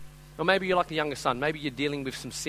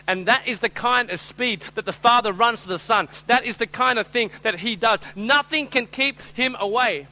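louder, higher, building climax; full-force preaching; 5 semitones higher, 5 dB louder than usual. Real, not acted. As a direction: drive the point home from the pulpit.

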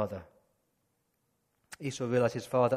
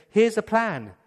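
bossy scheming mother; 6 semitones higher, 8 dB louder than usual. Retold or imitated. Rebecca scheming.

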